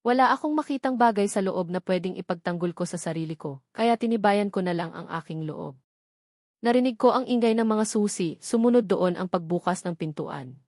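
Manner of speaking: ordinary delivery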